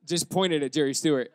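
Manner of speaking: ordinary talk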